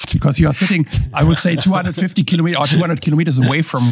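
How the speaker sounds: usual speaking voice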